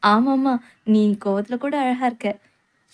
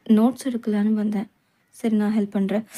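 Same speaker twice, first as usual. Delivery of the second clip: ordinary talk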